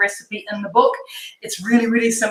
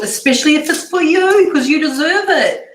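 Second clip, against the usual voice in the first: louder, higher, enthusiastic urging; excited encouragement; 6 semitones higher, 4 dB louder than usual. Real, not acted. Cook urging viewers to treat themselves, excited encouragement. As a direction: cheer the listener on, lifted and loud.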